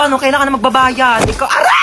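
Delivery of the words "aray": high, loud yelp of pain. Acted. Customer hurt in the scuffle, yelping high and loud. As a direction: yelp as if struck. Customer hit while collecting debt.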